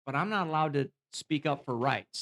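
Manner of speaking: normal talking voice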